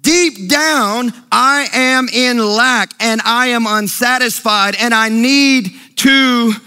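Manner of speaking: ordinary delivery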